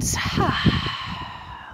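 long breathy sigh out; audible exhale sigh. Shown as a demonstration. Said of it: "let it out".

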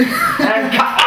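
a high, loud, laughing call of cut; mock director. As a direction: play the film director ending the scene, cracking up. Mock director ending the take.

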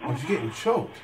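ordinary talk

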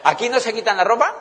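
ordinary talk, nothing marked

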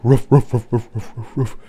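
imitated barks in a rapid string; dog barking. A narrator's reading. Storyteller imitating dogs chasing a ball, playful barking. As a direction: bark like a chasing pack, starting strong and tailing off. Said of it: "They barked".

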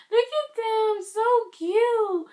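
high, loud fangirl squeal drawn out slowly; squealing with excitement. Real, not acted. Fan seeing the group photo for the first time, squealing excitedly. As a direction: gush over the photo like a starstruck fan.

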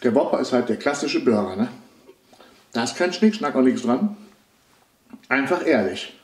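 talking between bites; slight chewing while speaking; mouth partly full. Real, not acted. Seen 3: Holds burger throughout; mouth looks partly full while talking between bites.

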